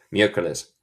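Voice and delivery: normal talking voice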